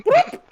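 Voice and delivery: high squeaky whoop sound; a quick high-pitched pop noise; cartoon whoop for a bobbing head. Head popping up past window.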